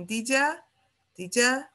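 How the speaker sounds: "did ya" with you reduced to ya, one linked word; relaxed accent reduction "did ya". Shown as a demonstration. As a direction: say "did ya" casually, vowel reduced. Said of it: "até vira um ia".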